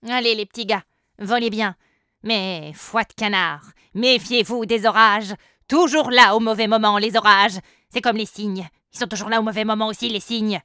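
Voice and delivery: loud, gruff duck gentleman; grumpy old duck. A narrator's reading. Duck character voice. (vieux canard bougon, fort)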